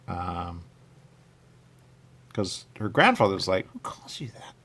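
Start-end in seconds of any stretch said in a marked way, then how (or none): 3.7-4.5 unvoiced, hushed retelling; whisper. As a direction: whisper it like gossip.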